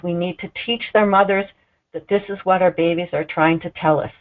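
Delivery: ordinary delivery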